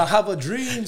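high, loud mock orator; hammed-up grand speech. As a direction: boom it like a famous speech, tongue in cheek. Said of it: "You know".

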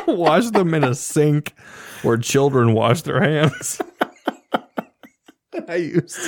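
words squeezed out through laughter; giggling while recounting; laughing. Real, not acted. Laughing while telling story.

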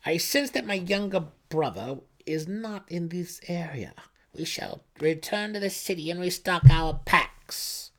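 high, imperious announcement; commanding high priestess. Acted. Priestess tells the patrol they return to the city. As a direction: address the patrol like a ruler. Brisa's voice.

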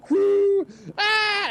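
high startled whoop and scream; mock scream. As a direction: yelp as if touched by a ghost. Mom throws pan, screams.

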